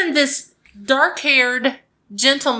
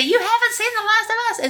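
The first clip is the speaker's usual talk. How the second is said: high, fast mock bad-guy voice; high villain voice; 12 semitones higher, faster than usual. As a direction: squeak it out like a cartoon villain. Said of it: "And they say".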